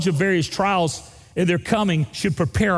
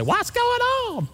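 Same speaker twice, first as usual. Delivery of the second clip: mock alarm. Mock panic running from bear.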